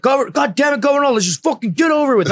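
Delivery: high, strained yell of a fed-up team leader; exasperated shout. Retold or imitated. Team leader yelling at his man to come over, high exasperated shout. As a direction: bark it out, fed up and impatient.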